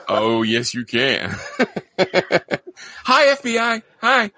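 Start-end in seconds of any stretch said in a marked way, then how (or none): 3.0-4.3 mock greeting. Joking greeting to the FBI.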